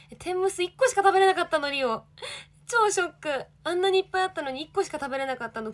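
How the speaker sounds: high, loud dramatic wail over the food; shocked whine. Real, not acted. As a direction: wail it like a tragedy.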